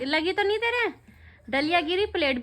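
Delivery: ordinary talk, nothing marked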